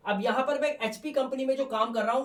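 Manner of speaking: ordinary talk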